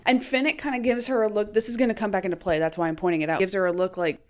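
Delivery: ordinary delivery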